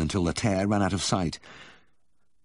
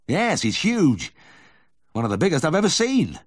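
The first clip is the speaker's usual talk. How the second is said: excited; 11 semitones higher, 6 dB louder than usual. Acted. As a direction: marvel at it out loud. Vet voiced in the story.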